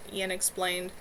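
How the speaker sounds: ordinary delivery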